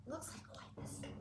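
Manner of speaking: hushed whisper with a gasp. Pretend. Telling kids about the storm in a whisper. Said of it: "whispered aside about storm".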